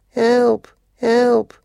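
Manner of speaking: very high, muffled cry for help. A narrator's reading. Trapped Georgie calls from under housekeeper, muffled and high. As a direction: squeak out a smothered call. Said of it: "they heard a muffled voice".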